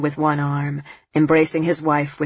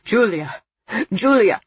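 desperate call; 6 semitones higher, 3 dB louder than usual. A narrator's reading. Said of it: "A desperate voice, barely muffled".

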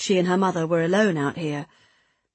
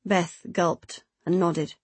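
ordinary delivery

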